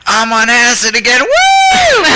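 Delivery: high full-voiced holler ending in a shrieked whoop; wild yell. Woman surprising the filmmaker up close, imitated wild holler. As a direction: explode into a whooping shout.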